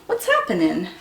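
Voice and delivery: usual speaking voice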